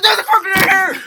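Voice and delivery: high, very loud alarm; panicked shout. Retold or imitated. Finding a cricket in the bedroom, panicked shout. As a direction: yell it out in comic panic.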